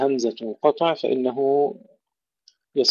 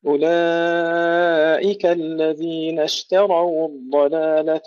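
slow, melodic tajweed chant; Quran recitation; 4 semitones higher, much slower than usual. Acted. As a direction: recite the verses slowly and melodically. (تلاوة مرتلة بطيئة)

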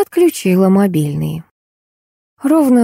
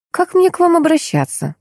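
much higher, courteous question; polite; 12 semitones higher than usual. A narrator's reading. Heroine asks the angry caller's name, politely. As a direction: ask sweetly and respectfully, voice lifted high. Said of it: "вежливо спросила я".

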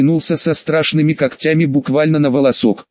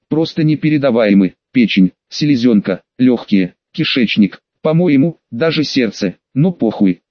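ordinary delivery, nothing marked

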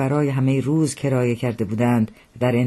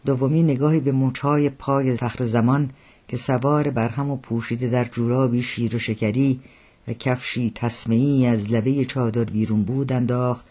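usual speaking voice

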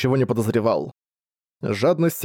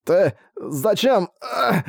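strangled, slow, higher gasping words; struggling against restraints; 8 semitones higher, much slower than usual. Acted. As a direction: fight for breath while held up. Guard lifted, choked by orc.

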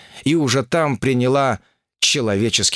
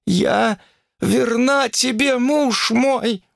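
high, drawn-out desperate wail; 13 semitones higher, much slower than usual. Acted. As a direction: wail like a terrified fox. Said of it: "выла она".

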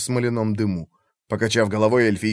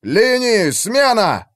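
high, urgent battle orders; shouted commands; 9 semitones higher, 4 dB louder, much slower than usual. A narrator's reading. Commander orders the lines to swap, shouted. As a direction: call out orders over the fighting.

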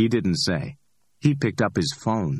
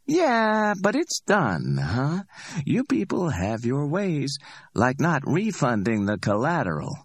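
higher, amused street hustler voice; cocky Ordell; 9 semitones higher than usual. Acted. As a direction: play Ordell, high and pleased with himself. Ordell teasing, high.

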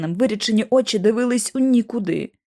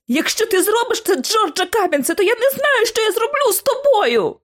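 tearful; 13 semitones higher than usual. A narrator's reading. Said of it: "Сльози залили мої очі".

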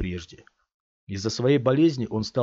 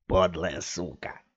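low, slow gutter curse; cursing thug; 3 semitones lower, slower than usual. A narrator's reading. Another thug swears at the mother, crude. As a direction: spit it out coarsely. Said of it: "выругался другой".

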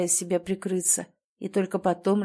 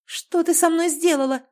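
high, loud accusing cry; furious, wounded question; angry accusation; 9 semitones higher, 5 dB louder than usual. A narrator's reading. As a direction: hurl the question at her in anger. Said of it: "Её голос дрожал".

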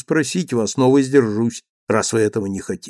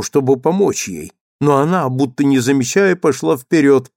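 plain reading voice

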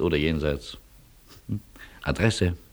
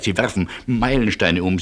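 ordinary delivery

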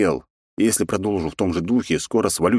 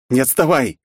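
urgent shout; 6 semitones higher, 4 dB louder than usual. Acted. Urging friend while fleeing.

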